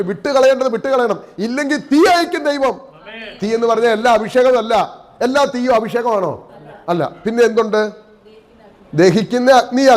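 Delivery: high, forceful pulpit warning; impassioned preaching. Real, not acted. Preacher warning believers that God will send fire, impassioned preaching. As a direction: build to a high, sustained prophetic cry.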